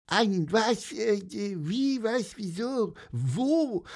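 high, bewildered little bird voice; confused bird voice. Acted. Bird waking confused in hammock, high stammering voice. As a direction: stammer like a just-woken bird.

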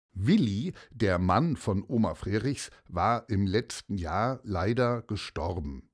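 ordinary delivery

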